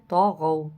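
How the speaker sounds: طغوا with a thick, full ط and غ; heavy letters. Shown as a demonstration. Teacher showing heavy letters in طغوا. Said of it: "دونوں موٹا کر کے پڑھنے والے لفظ ہیں".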